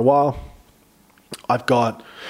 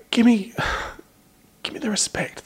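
mock demand; 9 semitones higher than usual. Mock demanding charity pitch.

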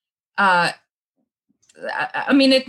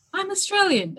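higher, softer, proudly announced; proud declaration; 10 semitones higher, 4 dB quieter than usual. Retold or imitated. Traveller announcing to strangers that she is Australian, a high proud declaration. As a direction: announce it brightly as if to strangers abroad. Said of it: "I'll be like".

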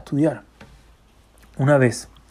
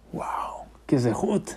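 hushed awe; 2 semitones lower, 11 dB quieter, much slower than usual. Acted. Driver awed opening the door.

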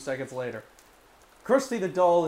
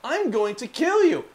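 lightly voiced creepy doll; 8 semitones higher, 5 dB louder than usual. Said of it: "The doll repeats it".

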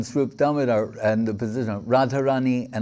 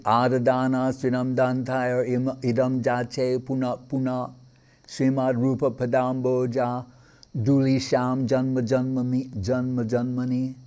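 verse recitation. Acted. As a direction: recite the Sanskrit verse in a measured, devotional cadence.

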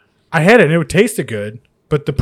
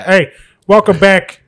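slow, loud, higher Chicago Italian greeting; Chicago Italian accent; 6 semitones higher, 8 dB louder, slower than usual. Pretend. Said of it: "really pour on the Chicago Italian accent".

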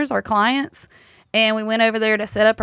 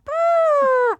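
imitated fart noise.

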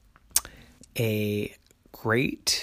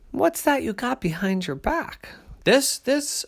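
bear cub voice; 14 semitones higher, 3 dB louder than usual. A narrator's reading.

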